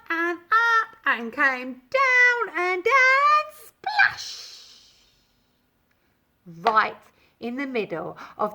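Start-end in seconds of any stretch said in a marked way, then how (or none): none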